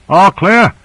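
narrated shout, pitched well up; shouted report. A narrator's reading. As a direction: call it out over the commotion.

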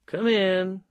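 high, carefree call to the door; lilting mild shout. A narrator's reading. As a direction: call out to a visitor, pitch well up. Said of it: "I shouted".

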